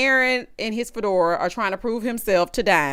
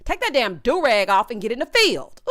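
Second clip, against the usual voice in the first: ordinary talk, nothing marked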